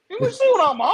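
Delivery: high, mock-baffled repeat of the question; mock incredulous echo. Echoing the stranger who asked about his chain, mock-baffled and high. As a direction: echo it back high and loud, playing dumb. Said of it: "I'm like".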